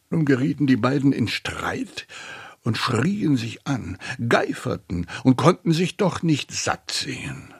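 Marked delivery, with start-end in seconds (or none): none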